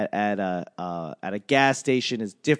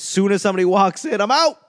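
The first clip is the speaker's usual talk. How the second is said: fast, then a higher, louder I'm out; yelling; 6 semitones higher, 5 dB louder, faster than usual. Acted. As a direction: yell it as you bolt from the gym. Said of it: "I don't know why I'm yelling".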